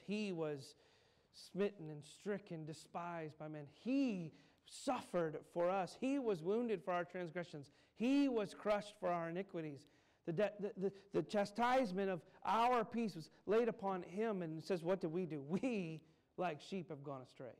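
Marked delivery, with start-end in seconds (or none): 3.8-14.4 rising, louder and louder sermon cry; preaching at full force. Acted. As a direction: build the preaching up to a loud peak.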